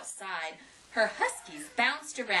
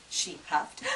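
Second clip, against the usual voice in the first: ordinary delivery, nothing marked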